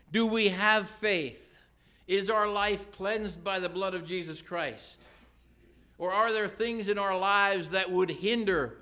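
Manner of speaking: loud, high, driving sermon delivery; preaching at full force. Acted. Preacher challenging his congregation with loud, pressing questions. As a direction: hammer the questions at the pews.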